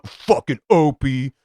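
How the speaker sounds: hammed-up bitter cursing; mock bitter. Podcaster showing how he didn't sound about Opie, mock bitter. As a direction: curse him in a put-on bitter voice. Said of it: "I wasn't bitter on that episode going".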